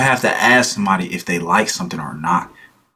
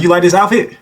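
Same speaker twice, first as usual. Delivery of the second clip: imitated girlfriend; 7 semitones higher than usual. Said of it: "your girlfriend be like".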